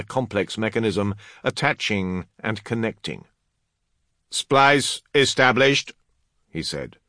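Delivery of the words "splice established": slow, high, loud status report; Master of Artifice. Acted. Artificer reporting the repair done, high loud announcement. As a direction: announce it formally in a raised voice.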